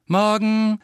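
hell und viel höher, lauter Gruß; strahlend. A narrator's reading. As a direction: ein freudig strahlendes Morgen. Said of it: "begrüßte Harry strahlend". (strahlend begrüßt, hoch und laut)

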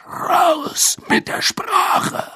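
höhnisches Flüstern, langsam; verächtlich zischen; höhnisch geflüstert. Acted. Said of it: "höhnte Moody". (Moody verhöhnt Karkaroff im Flüsterton)

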